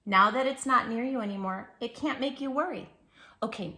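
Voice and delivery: ordinary delivery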